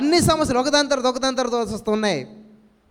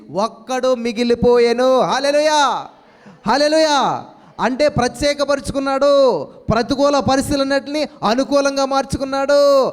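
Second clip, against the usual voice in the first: loud, higher, slow and ringing, shouting hallelujah; full-force preaching; 3 semitones higher, 4 dB louder than usual. Acted. Pastor rousing his congregation with louder, higher preaching. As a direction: preach it out to the church with rising fervour.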